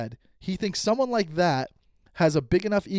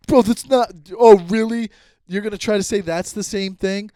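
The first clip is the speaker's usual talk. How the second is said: louder, higher, imitating an incredulous accuser; retold indignant challenge; 5 semitones higher, 8 dB louder than usual. Friend challenges him mid-argument, mock-indignant and loud. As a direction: act out his scoffing disbelief.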